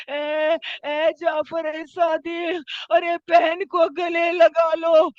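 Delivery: mournful, drawn-out call; anguished calling out. Acted. Imam calling his son to embrace his sister, wailing. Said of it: "آواز دی ہوگی".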